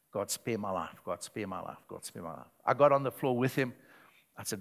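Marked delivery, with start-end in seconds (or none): 0.1-2.5 pleading for his life, each plea quieter and lower; pleading, fading out. Retold or imitated. Man on the floor begging God, fading desperate plea. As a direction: beg softer and softer until almost gone. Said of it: "wept and started pleading with God".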